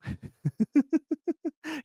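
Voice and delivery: high giggling laugh; stifled high chuckle before the punchline; soft, high run of giggles. Real, not acted.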